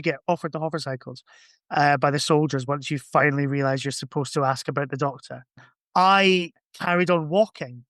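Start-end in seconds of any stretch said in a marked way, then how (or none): none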